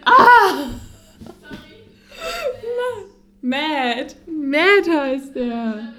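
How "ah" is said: high, sharp "Ah!"; short excited shriek; sudden scream. Real, not acted. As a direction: cry out high as it clicks. Sudden cry of realisation.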